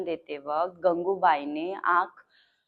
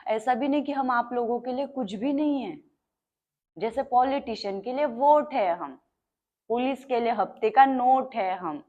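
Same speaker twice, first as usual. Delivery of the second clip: raised, higher-pitched public oratory; higher and louder, rallying a crowd; impassioned protest; 5 semitones higher, 4 dB louder than usual. Acted. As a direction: drive the grievance out to the crowd. Rousing public speech, bitter list.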